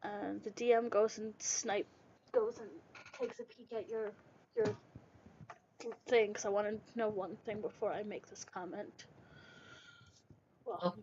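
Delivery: hoarse, losing his voice; raspy whisper. Real, not acted. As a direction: rasp through a failing voice. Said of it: "sorry, I'm losing my voice".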